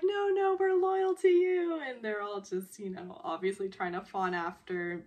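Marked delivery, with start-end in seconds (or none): 0.0-1.8 high, loud mock pleading; fawning protest. Technicians trying to fawn after their boss, loud fawning protest. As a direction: protest loudly, pitched way up.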